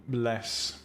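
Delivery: ordinary talk, nothing marked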